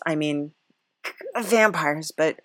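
ordinary talk, nothing marked

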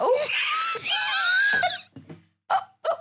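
high shocked laughing squeal. Real, not acted. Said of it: "I was like".

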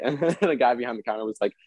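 normal talking voice

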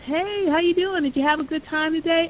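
friendly greeting. Retold or imitated. Player greets lingering kid.

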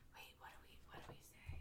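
hushed, breathy whisper. Real, not acted. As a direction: whisper the question under your breath. Whispering to the table mid-scene.

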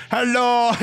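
bad Eastern European accent. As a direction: say "Hello" in a clumsy mock Eastern European accent. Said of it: "That was an Eastern European accent".

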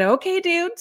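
high, startled "okay"; surprised okay. Retold or imitated. Mother answering her small son about the lady, surprised. Mother reacting to son's ghost story.

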